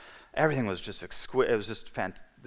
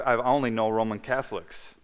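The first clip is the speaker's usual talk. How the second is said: ordinary delivery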